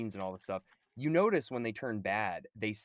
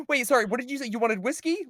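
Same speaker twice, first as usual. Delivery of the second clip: much higher and louder mock-airhead bartender voice; 14 semitones higher, 8 dB louder than usual. Mocking Phoebe Cates as the distracted bartender, high and loud. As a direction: squeal it like a ditzy bartender caught off guard.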